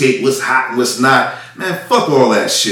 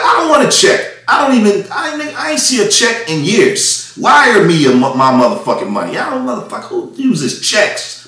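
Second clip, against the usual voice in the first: retold angry outburst; 8 semitones higher than usual. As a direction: blow up at the rep over the wire transfer.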